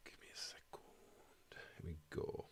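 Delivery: hushed whisper aside. Real, not acted. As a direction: whisper it off to the side.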